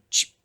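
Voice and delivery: short "ch" rattle; vocal percussion hit; rice-shaker imitation. Shown as a demonstration. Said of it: "I was just going".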